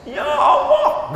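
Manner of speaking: high, drawn-out wail, imitated; mock weeping. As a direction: wail it slowly as a staged cry to God. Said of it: "saya tes nangis". (pura-pura meratap tinggi)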